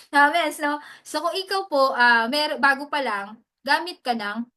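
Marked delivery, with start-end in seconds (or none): none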